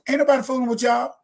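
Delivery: defiant outburst. Real, not acted. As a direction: spit it out in a rush of defiance. Defiant climax of rant.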